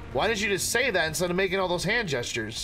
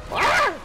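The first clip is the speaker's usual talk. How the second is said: short, very high, loud scream; startled scream; 6 dB louder than usual. Real, not acted. Gamer struck suddenly, screams high and loud. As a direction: yelp as you are struck.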